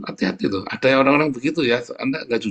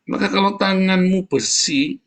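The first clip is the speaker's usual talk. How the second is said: slow, high, loud preacher's build; fervent preaching; 9 semitones higher, 5 dB louder, slower than usual. Acted. Preacher exhorting the congregation, slow and fervent. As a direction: drive the scripture home from the pulpit.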